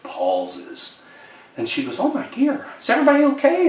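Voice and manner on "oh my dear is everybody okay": much higher, rising panic, imitated; alarmed old lady. Imitating the aunt fearing the worst on the phone. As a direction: gasp it out, worried for everyone.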